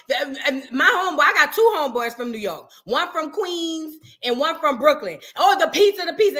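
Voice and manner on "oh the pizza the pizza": hammed-up swooning over pizza, louder; mock New Yorkers raving about pizza.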